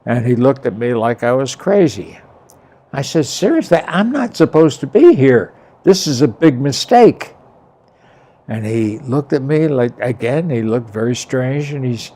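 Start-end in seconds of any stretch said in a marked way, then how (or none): 3.2-7.4 high, loud, emphatic protest; earnest retold objection; retold insistence. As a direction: press the point, pitch jumping up. Retold pleading that it's a mistake.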